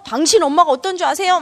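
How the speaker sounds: high, loud mock-angry wife; acted anger. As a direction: lash out like an indignant wife. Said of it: "막 화를 내면서". (화내는 아내 흉내로 높고 크게)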